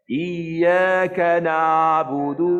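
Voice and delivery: sung ain of na'budu, throat far too open; hammed-up wide-open ain; exaggerated wrong tajwid, over-opened; overopen recitation. Shown as a demonstration. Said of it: "tu melampau sangat pula bukanya".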